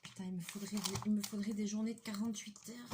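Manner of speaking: ordinary delivery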